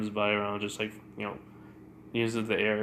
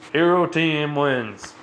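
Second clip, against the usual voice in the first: loud, slow, raised proclamation; announcer's call; 4 semitones higher, 7 dB louder, slower than usual. Retold or imitated. Announcer declares the exercise won, loud proclamation. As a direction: call out the winner, stretched out.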